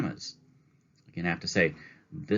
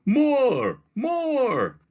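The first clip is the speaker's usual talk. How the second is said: much higher, loud chanting call; crowd shouting; 7 dB louder than usual. Acted. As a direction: cheer for an encore. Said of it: "voices shouting".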